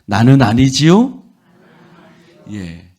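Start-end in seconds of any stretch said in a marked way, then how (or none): 0.0-1.2 loud call-and-response repeat with a laugh; louder group repeat with a laugh; congregation repeats. Shown as a demonstration. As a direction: say it back all together, loudly. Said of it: "한번 따라해 봅시다".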